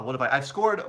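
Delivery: ordinary delivery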